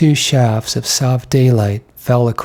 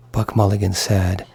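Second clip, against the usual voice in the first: ordinary delivery, nothing marked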